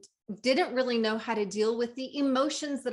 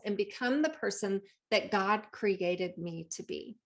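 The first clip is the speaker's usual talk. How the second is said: ordinary talk, nothing marked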